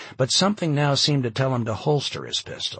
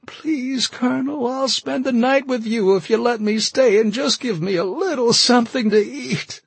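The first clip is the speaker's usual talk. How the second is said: sobbing; 7 dB louder than usual. A narrator's reading. Carol begging the colonel to let her stay, sobbing. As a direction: plead through sobs. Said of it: "she sobbed".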